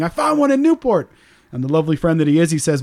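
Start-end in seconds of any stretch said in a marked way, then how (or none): 0.0-1.1 giggly excitement. Retold or imitated. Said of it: "I then run giggling to Just John".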